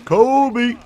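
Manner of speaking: loud high yell; shouting Kobe. Acted. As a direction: yell it out like a battle cry. Shouting Kobe while attacking.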